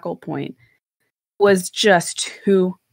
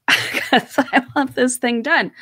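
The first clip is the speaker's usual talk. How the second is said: laughing through a slow, higher line; 6 semitones higher, slower than usual. Retold or imitated. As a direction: laugh at your own stubbornness. Laughs at own stubborn resolve.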